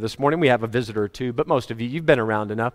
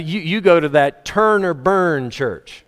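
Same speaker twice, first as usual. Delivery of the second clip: ordinary delivery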